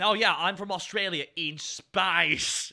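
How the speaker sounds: drawn-out, hammed-up Australian accent; broad Australian accent. Writer mimicking the alien from Space Australia, broad Australian accent. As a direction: go all in on a slow mock Australian twang. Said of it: "we just went all in".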